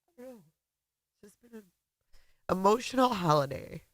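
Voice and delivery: very soft, choked up with feeling; tearful. Real, not acted. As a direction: trail into a near-silent, emotional murmur. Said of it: "I'm not crying 'cause I'm sad".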